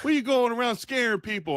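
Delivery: mock Texas accent; put-on Texan accent. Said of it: "Now that we're doing these stupid accents".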